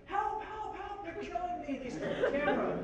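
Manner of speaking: high, half-laughing mock panic; panicked cry for help. Dave running out of the building, calling for help in a mock panic. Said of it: "Dave comes running out and he's like".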